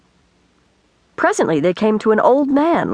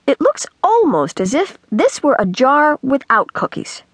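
musing in a higher storybook voice; thoughtful; 7 semitones higher than usual. A narrator's reading. Girl inspects the holed can, thoughtfully musing. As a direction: ponder it aloud, lightly in character. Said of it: "she said thoughtfully".

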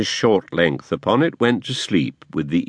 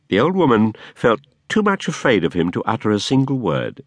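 ordinary delivery, nothing marked

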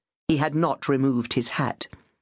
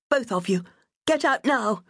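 she yells them out, much higher on the final command; 8 semitones higher than usual. A narrator's reading. Charlotte, pistol raised, orders both men out, shouting. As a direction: build from firm to a high shouted "get out now".

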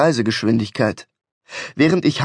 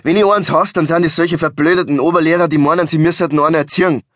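quick, indignant Bavarian rant; 4 semitones higher, 4 dB louder, faster than usual. Acted. Driver rails at know-it-all motorists, quick scolding rant. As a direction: rattle off the complaint without a pause. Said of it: "fuhr er zu schimpfen fort".

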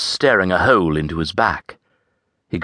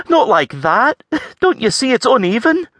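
high, fussy lass voice; critical Scots lass; 4 dB louder than usual. A narrator's reading. Avril criticising the farrier's hoof work, fussy. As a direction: nitpick his work, lilting and high.

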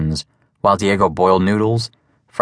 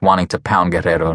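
ordinary delivery, nothing marked

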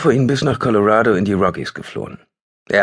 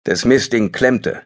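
plain reading voice

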